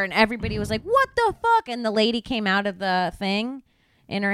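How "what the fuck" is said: very high, louder mock shock; shocked outburst. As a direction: squeal it out, stunned. Bystanders shocked in story.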